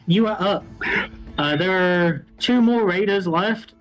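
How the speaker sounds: ordinary delivery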